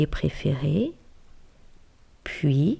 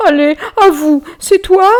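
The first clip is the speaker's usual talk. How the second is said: higher, louder anxious plea; pleading bear; 10 semitones higher, 9 dB louder than usual. Acted. Frightened bear begging the mouse to own up, higher and loud. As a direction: beg the mouse to confess.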